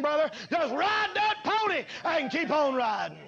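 high, driving preacher's chant, each line climbing; preaching at full force. Acted. Preacher urging the church to keep going, in a soaring chant. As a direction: build it like a revival call.

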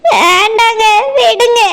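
crying plea to her husband. Acted. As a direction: cry out to stop him through tears. Second wife cries out in quarrel.

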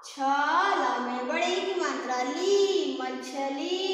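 slow spelling, long 'ee' in ली and मछली; stretched long vowel. Shown as a demonstration. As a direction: draw out the end of मछली. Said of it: "थोड़ा खींच करके पढ़ा जाता है". (मछली की ी को खींचकर)

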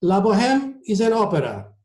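put-on high-pitched Italian accent. A narrator's reading. Maestro corrects passenger about opera, Italian accent. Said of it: "in his heavy Italian accent".

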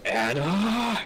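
sighing, calling her name up the stairs; fed-up shout for the girl; annoyed call upward at the mischief-maker; exasperated call. Acted. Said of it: "I sigh and go".

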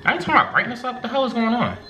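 suddenly spooked and confused; startled. Real, not acted. Spooked by dark screen.

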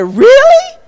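high, loud delighted really; surprised child. Acted. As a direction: gasp it out in disbelief.

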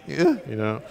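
ordinary delivery

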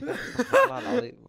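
slow high laughing oath; laughing through pain. Real, not acted. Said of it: "والله وجعني بطني".

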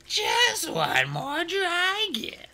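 slow high coaxing purr; slow high silky queen. A narrator's reading. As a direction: purr it temptingly. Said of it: "Scarlet purred".

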